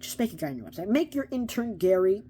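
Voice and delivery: loud, insistent appeal to the company; exasperated shouted request; emphatic plea. Real, not acted. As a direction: raise the voice and demand it. Calling out to DC.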